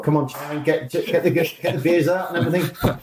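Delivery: teasing shout for the beers; cheeky call. Retold or imitated. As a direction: egg the chairman on, high and bright. Players calling to the chairman.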